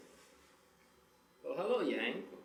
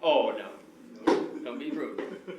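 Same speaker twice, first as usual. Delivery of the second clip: mock scolding; 8 dB louder than usual. Scolding the rude dummy.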